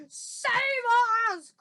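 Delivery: high, loud giant's scream; screamed plea. Acted. Nightmare-struck giant cries for rescue, screaming. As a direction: scream it in panic, stretching the first word. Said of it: "screamed the flesh lump eater".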